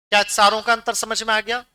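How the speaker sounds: normal talking voice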